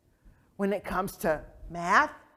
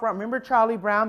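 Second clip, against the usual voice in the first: ordinary talk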